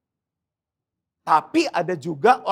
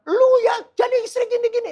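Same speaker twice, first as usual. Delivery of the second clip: high-pitched, raised-voice scolding; shrill-pitched mock rant, much higher than usual; mock yelling; 4 dB louder than usual. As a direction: imitate a husband yelling at his wife. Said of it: "nggak pernah ngomong yang teriak-teriak".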